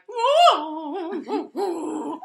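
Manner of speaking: high, loud whooping through a laugh; imitated concert crowd. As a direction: mimic a distant crowd whooping. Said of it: "all I hear in my dream".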